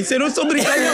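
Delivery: high, laughing protest; high squeaky denial breaking into laughter; laughing mock protest. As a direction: giggle out the denial. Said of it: "Fica bem".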